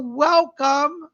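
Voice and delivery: ordinary talk, nothing marked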